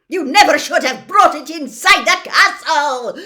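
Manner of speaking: yelled. Acted. McGonagall yelling at the Minister, furious. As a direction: yell it at him in outrage. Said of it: "yelled Professor McGonagall".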